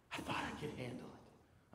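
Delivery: breathy whisper of the same line; whispered repeat. Retold or imitated. Brother repeating his confession, whispered. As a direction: let it fade to a whisper.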